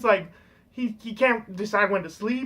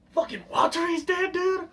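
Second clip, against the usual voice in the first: higher-pitched, hammed-up angry shouting; mock vengeful outburst; 3 semitones higher than usual. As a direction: imitate a hot-headed vow of revenge. Mock-acting the movie detective's vengeful outburst.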